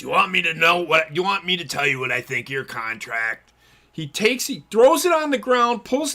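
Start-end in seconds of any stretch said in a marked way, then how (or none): 0.0-3.5 a lightly voiced manager sneering at the contract; mock threat from the manager. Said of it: "I can do the voice".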